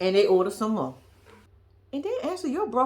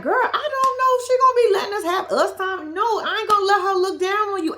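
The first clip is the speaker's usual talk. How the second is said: high, loud put-on Travis voice; mimicking Travis; 10 semitones higher, 5 dB louder than usual. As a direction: play Travis as a higher, louder imitation. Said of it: "Travis like".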